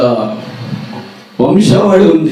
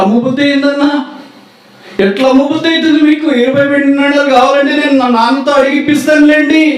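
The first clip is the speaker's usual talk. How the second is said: put-on high pleading boy; 13 semitones higher than usual. Joseph pleading with brothers.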